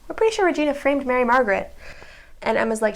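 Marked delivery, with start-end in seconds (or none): 0.1-1.7 fast. Real, not acted. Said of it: "not proven".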